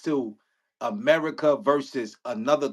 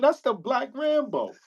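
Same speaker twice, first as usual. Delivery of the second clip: awed bragging; 7 semitones higher, slower than usual. Retold or imitated. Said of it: "I'ma tell my children".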